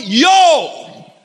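high, loud hype call to the crowd; exclaiming out loud. Retold or imitated. Preacher shows Jesus exclaiming to the crowd, loud hype call. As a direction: shout it out like calling your people over. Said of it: "It's like going".

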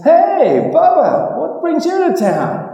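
high, loud friendly call; Turnbull hailing Bubba. Acted. Malcolm Turnbull greeting Bubba on Parliament steps, high and hearty. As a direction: call out like a chummy politician.

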